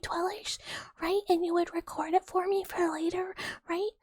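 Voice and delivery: high, wheedling mouse voice begging for a favour; pleading little mouse. Acted. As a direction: coax them like a sweet scheming little mouse. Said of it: "You wouldn't deny the mouse that".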